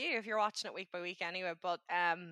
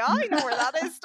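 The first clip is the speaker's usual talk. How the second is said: loud, higher, laughing exclamation; excited recognition; 7 semitones higher, 11 dB louder than usual. Retold or imitated. Viewer spotting a familiar street on TV, excited and laughing. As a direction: blurt it out high and loud through laughter.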